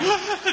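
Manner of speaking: high sharp panicked gasp. Retold or imitated. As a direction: suck in air like you're hyperventilating. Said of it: "Hamza is hyperventilating".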